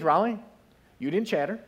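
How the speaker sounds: ordinary delivery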